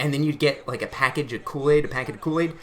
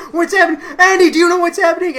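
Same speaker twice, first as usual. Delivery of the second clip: frantic shrill call, much higher and louder; panicked mom; 13 semitones higher, 10 dB louder, faster than usual. Retold or imitated. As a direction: mom demanding answers in a panic. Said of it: "My mom's going".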